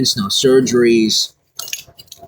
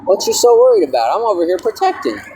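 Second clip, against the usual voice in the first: mock indignant; 9 semitones higher than usual. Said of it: "And I'm like".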